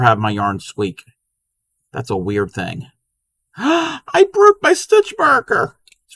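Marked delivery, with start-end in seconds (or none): 3.2-5.8 sudden gasp, then a much higher, slow alarmed line; real shock as the stitch marker breaks; startled gasp. Real, not acted. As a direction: caught off guard mid-crochet, high and gasping. Gasps as the marker snaps.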